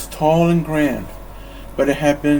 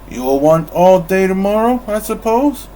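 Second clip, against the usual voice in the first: louder, higher surly demand; growled question; 5 semitones higher, 6 dB louder than usual. Acted. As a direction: growl it grudgingly. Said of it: "he growled".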